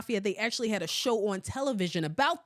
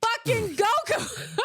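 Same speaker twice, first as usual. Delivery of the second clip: high squealing laugh through the name; laughing disbelief; 13 semitones higher, much slower than usual. Real, not acted. Laughing at her baby's father over the name. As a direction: crack up saying the name.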